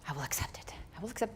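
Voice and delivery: whispered to himself, barely voiced. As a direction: breathe the words as a private vow. Silent retreat, calming himself.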